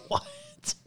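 a shrieking laugh breaking into a squeaky what; high squealing laugh. Real, not acted. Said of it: "The hell is happening".

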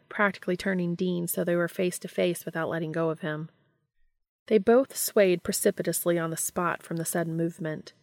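ordinary delivery

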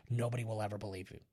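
ordinary talk

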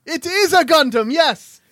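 high, emphatic mock-British confirmation; shouted British accent. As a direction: confirm it triumphantly in bad British. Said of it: "shouting is my British accent".

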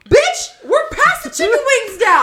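high, loud laughing retort; laughing mock yell. Retelling snapping at Fireball, loud laughing retort. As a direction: yell it while cracking up.

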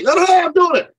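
high, loud mock refusal; stubborn client. Client refusing his coach, high and loud. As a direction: brush off the advice.

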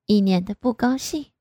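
ordinary delivery

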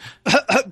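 sudden high choking cough from strong liquor. Retold or imitated. After downing grappa in one go, a choking cough.

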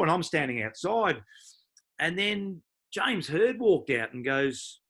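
ordinary talk

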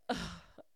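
soft, voiceless sigh; exasperated sigh. Real, not acted. Reader who waited to read on with listeners, exasperated sigh. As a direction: let out a sigh of overwhelm. Fan sighing over the cliffhanger.

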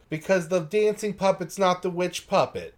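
ordinary talk, nothing marked

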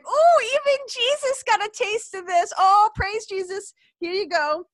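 gleeful high praise; delighted gasp. Acted. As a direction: squeal it like a thrilled churchgoer. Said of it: "And I was like".

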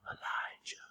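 breathy whispered name; hushed whisper. Shown as a demonstration. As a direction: whisper it like God's small voice. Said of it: "Just a small voice".

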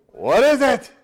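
high, loud puzzled question; baffled exclamation. Acted. People finding flaky stuff on the ground, loud baffled exclamation. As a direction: blurt it out in acted bewilderment.

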